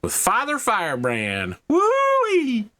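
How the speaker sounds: Southern drawl announcer whoop; hammed-up Southern hype, rising to a whoop; super southern. GM hyping the break for players, hammed-up Southern. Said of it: "I don't know why I went super southern there".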